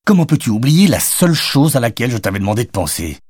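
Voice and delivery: irritated, emphatic questioning; exasperated. A narrator's reading. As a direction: scold a forgetful friend. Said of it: "s'énerva Jonathan".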